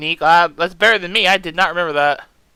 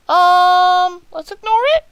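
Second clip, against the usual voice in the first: mock innocent; 11 semitones higher, 6 dB louder, slower than usual. Hides a suspicious note.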